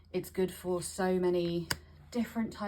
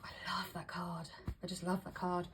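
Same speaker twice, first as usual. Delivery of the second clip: whisper; 7 dB quieter than usual. Real, not acted. Whispered aside about loved card.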